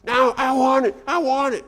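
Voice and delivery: high, loud tantrum wail; screaming child. Retold or imitated. Toddler in the store screaming at mom, high loud wail. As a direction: howl it like a toddler. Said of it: "he gets louder and louder".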